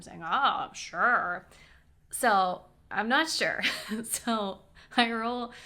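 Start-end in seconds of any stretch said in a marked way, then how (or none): 0.2-1.5 louder, drawn-out mock agreement; sarcastic. Driver pretending to agree to the window, sarcastic. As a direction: stretch both words with sarcasm. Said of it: "sounds like sarcasm".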